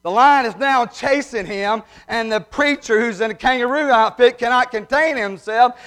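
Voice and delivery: usual speaking voice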